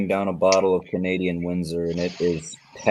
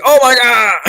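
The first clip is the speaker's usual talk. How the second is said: high, loud imitation of an outburst; mock shocked yell; 11 dB louder than usual. Imitating him coming in soon, mock shocked yell. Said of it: "He's gonna be like".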